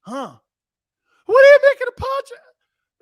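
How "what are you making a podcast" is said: loud, very high falsetto question; hammed-up squeaky radio host; squeaky Pinocchio voice. Shown as a demonstration. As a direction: squeal it like a puppet. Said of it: "I sound like I'm Pinocchio".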